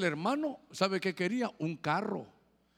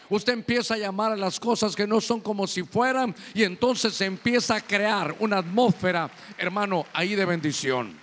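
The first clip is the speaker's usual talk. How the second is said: preaching at full force; 5 dB louder than usual. Real, not acted. Preacher builds to fervent climax.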